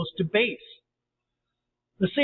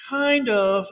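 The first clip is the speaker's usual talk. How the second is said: high, raised reply; yelled; 6 semitones higher, 4 dB louder than usual. A narrator's reading. Sally to James from the cave, mildly yelled. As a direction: call back up out of the hole. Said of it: "Sally yelled".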